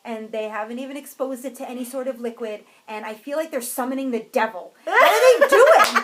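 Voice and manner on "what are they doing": loud, high laughing disbelief; laughing exasperation. Real, not acted.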